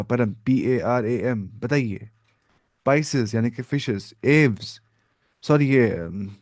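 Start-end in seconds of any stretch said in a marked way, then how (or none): 0.0-1.5 slow spelling. Shown as a demonstration. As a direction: spell out P A R A M letter by letter, slowly. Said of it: "परम याद रखना था".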